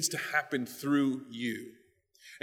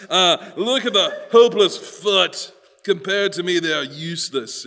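comic haughty character, pitched up; haughty eye; 3 semitones higher, 8 dB louder than usual. Acted. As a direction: sneer down at the foot as a self-important eye. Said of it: "That's how the eye talks".

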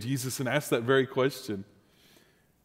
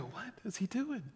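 puzzled aside; 7 semitones higher, 15 dB quieter than usual. Retold or imitated. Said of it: "I would always kinda go".

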